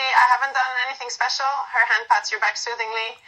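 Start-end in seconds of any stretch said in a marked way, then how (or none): none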